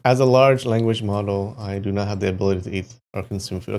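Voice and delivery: quick robotic read of the bot's answer; fast, mock-formal chatbot read-out; reading out the reply. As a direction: rattle off the canned AI reply fast. Reading the AI's answer aloud.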